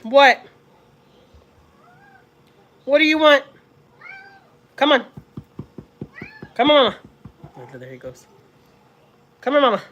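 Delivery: loud calls to the dog; calling loudly. Real, not acted.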